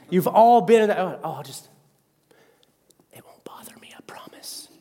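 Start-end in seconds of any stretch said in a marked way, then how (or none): 1.0-4.7 hushed, breathy whisper of a fake promise; mock whisper. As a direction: whisper it like you don't mean it. Imitating someone hiding their phone.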